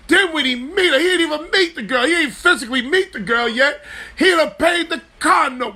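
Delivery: high, laughing disbelief at the story; cracking up while telling how he paid the car note; excited laughing retelling. Real, not acted. To listeners about Mo Stroller, high laughing disbelief.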